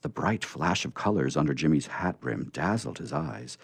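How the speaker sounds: ordinary delivery, nothing marked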